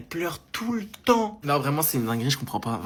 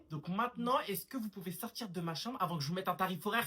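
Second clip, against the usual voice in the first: ordinary delivery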